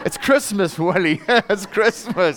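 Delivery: high, loud, laughing devil's whisper-turned-tease; mock devil, chuckling and coaxing; laughing tempter. Voicing the devil's tempting thought.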